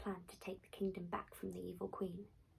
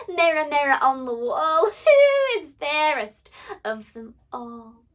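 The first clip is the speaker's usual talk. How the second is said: triumphant; 5 semitones higher, 17 dB louder than usual. Acted. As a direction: savour the win, laughing. Triumphant queen asks mirror again.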